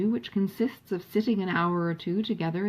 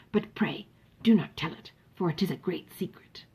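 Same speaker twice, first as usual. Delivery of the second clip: audible whisper. A narrator's reading. Sir John confiding a secret name to the sisters, audible whisper. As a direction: whisper it so everyone hears. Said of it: "in a very audible whisper".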